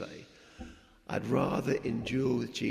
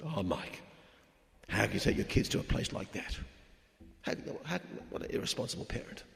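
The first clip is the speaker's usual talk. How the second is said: 1 mock disapproving folks; 3 semitones lower, 5 dB quieter than usual. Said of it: "folks said to us".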